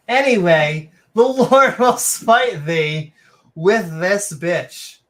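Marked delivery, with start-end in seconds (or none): 1.1-5.0 slow, loud booming prophet; mock biblical prophet. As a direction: thunder it out like a preacher. Reading scripture in mock voice.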